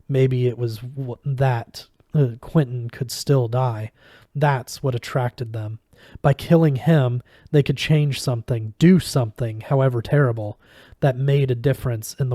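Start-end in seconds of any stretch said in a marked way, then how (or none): none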